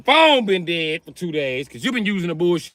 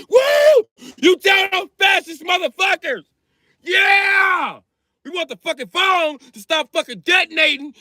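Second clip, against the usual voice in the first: cheering crowd; 7 semitones higher than usual. Retold or imitated. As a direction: whoop and cheer him on. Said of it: "They was cheering for him like".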